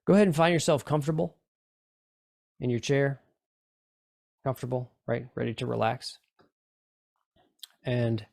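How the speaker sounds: relaxing hypnosis guide voice; guided meditation. Acted. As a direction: ease them into the chair. Leads a relaxation induction.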